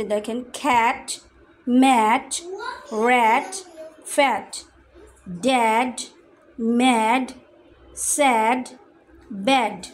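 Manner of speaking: "cat", "mat", "rat" and "bad" each said with a wide æ; slow drill, one word at a time; pronunciation drill. Shown as a demonstration. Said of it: "সেগুলোকে সুন্দর করে সাজিয়েছি".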